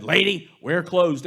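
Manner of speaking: grocer retort. Acted. Grocer answers old woman.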